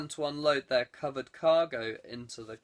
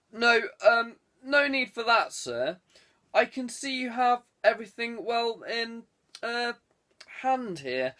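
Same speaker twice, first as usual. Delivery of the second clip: flustered superintendent; 7 semitones higher, 3 dB louder than usual. Acted.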